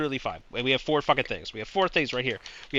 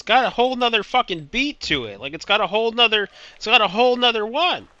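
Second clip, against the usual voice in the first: louder, higher, fast exasperated rant; frustrated yelling; 9 semitones higher, 10 dB louder, faster than usual. Real, not acted. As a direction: blow up at the puzzle that makes no sense. Frustrated at the bird puzzle.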